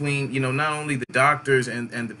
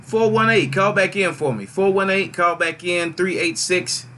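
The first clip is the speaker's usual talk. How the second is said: calling out on air; 5 semitones higher, 4 dB louder than usual. Real, not acted. Host calling caller numbers on air.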